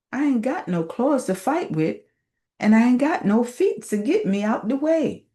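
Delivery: a lowly snake grumbling its troubles to God; complaining snake. Acted.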